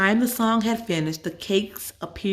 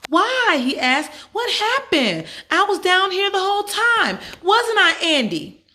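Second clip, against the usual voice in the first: put-on much higher, louder whiny boy; high whiny boy protesting; fake-innocent boy; 13 semitones higher, 5 dB louder than usual. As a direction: play innocent to Mom. Rick playing innocent.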